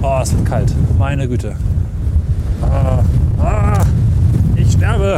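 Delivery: hammed-up dying wail in the cold; overacted death scene. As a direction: moan loud, pretend to die from cold. Cold wind, dramatic dying. (übertriebene Sterbeszene)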